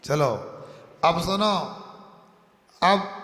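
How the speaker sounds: normal talking voice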